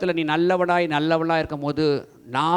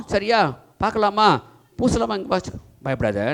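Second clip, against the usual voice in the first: ordinary talk, nothing marked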